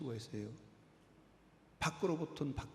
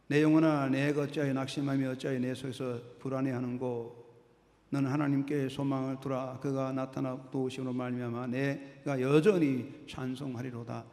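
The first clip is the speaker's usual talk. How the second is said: loud, earnest reading of the psalm; scripture reading; 4 dB louder than usual. Acted. Preacher reading the psalm to his church, loud and earnest. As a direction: proclaim the verse to the congregation. Reads Psalm verse aloud.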